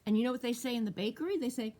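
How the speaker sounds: plain reading voice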